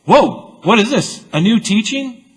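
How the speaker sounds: high, loud amazed gasp; astonished scribes. Acted. Scribes stunned by Jesus' teaching, astonished outcry. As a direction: gasp as if you can't believe your ears. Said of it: "the scribes and Pharisees ... say".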